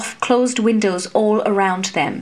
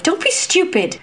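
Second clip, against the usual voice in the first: much higher, urgent cry; alarmed cry; 9 semitones higher than usual. A narrator's reading. Nun cries out against the reckless wager, high alarm. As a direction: beg her to stop, in alarm. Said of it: "Lairon cried".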